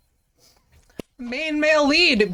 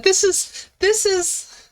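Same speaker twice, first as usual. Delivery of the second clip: high squeak; 7 semitones higher, slower than usual. Real, not acted. Voice jumps before breaking off.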